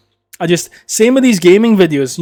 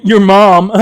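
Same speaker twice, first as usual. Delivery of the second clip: loud, high mock guffawing; obnoxious gamer lads; 7 semitones higher, 7 dB louder than usual. Gaming lads cracking a lame joke, loud mocking laughter. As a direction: deliver a lame punchline then roar with fake laughter. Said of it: "listen to these dickheads like".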